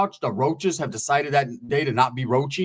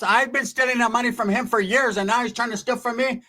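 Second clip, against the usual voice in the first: higher mock aunt voice; indignant aunt; 7 semitones higher than usual. Aunt griping about money from him, high imitation. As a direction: play an aggrieved aunt, pitched up.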